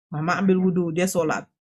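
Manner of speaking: ordinary talk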